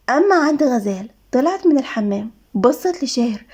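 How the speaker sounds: usual speaking voice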